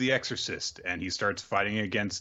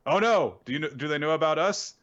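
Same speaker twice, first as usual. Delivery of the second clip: higher, hurried mock worry; mock panic; 6 semitones higher than usual. Retelling Kazuha asking Pyra if they're found out, in mock panic. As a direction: imitate someone anxiously asking their accomplice.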